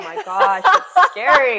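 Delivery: high, loud giddy nerves with a laugh; excited squeal. Real, not acted. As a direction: squeal it out, thrilled and scared. Nervous about upcoming pageant.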